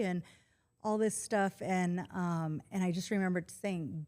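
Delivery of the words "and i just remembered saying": normal talking voice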